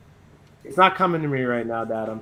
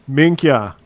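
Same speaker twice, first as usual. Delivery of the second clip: put-on Arnold accent; 11 dB louder than usual. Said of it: "Arnold would probably be like".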